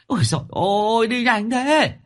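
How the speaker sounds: high, loud whining cry of despair; frightened wail. Acted. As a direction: shriek it high and loud, a scared lame man left behind. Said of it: "sợ mà gào lên".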